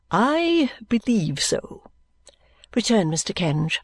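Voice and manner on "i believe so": high, slow, drawn-out assent; pompous lawyer. Acted. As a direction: swell grandly on the first word. Kenge's answer, echoed by Vholes.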